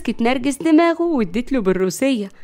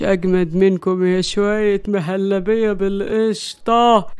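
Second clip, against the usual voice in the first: lower, spaced-out stoned voice; drugged; 3 semitones lower than usual. A narrator's reading. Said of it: "وهو تحت تأثير المخدر". (مسطول تحت تأثير المخدر)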